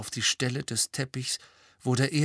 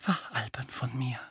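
soft, breathy murmur; 3 semitones higher, 5 dB quieter than usual. A narrator's reading. Molly murmuring after her crying fit. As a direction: murmur it, embarrassed and spent. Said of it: "murmelte sie".

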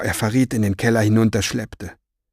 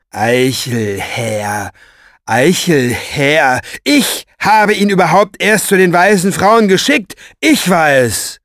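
drawn-out mocking name, then a high, loud outburst; indignant ranting; 5 semitones higher, 7 dB louder, slower than usual. A narrator's reading. Orpheus yells down the stairs claiming the credit, indignant. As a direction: call it after them, building to outrage. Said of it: "schallte Orpheus Stimme ihnen auf der Treppe nach".

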